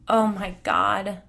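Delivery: grief-stricken wail at the news. Acted. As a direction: let it wrench out of her. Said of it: "Colleen wailed".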